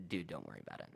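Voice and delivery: low, soft brush-off; easygoing, hushed comfort; imitated reassurance. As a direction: drop down low and quiet to wave it away. Manager reassures on the phone.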